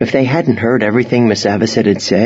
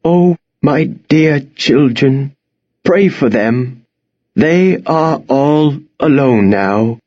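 slow, higher lament close to tears; voice hitching; 5 semitones higher, slower than usual. A narrator's reading. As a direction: draw it out, grieving for the children. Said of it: "Her voice began to hitch".

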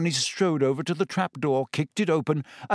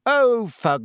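loud, slow, fed-up outburst; loud exasperation; 6 semitones higher, 5 dB louder, slower than usual. Acted. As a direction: Hermione cutting the teacher off loudly. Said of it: "said Hermione loudly".

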